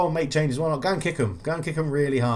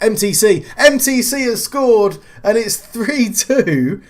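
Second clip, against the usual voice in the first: excited goal call; 10 semitones higher, 9 dB louder, slower than usual. Real, not acted.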